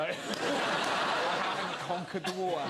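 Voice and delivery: slow grandiose mock echo; grand slow repeat. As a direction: savour "water" slowly with a laugh. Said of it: "People don't say that often enough".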